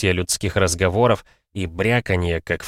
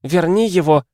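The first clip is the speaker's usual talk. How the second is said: high, loud mother's command; shouted demand; 10 semitones higher, 5 dB louder than usual. A narrator's reading. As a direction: yell the order at the boy. Mother interrupts, demanding the master. (требовательный крик матери)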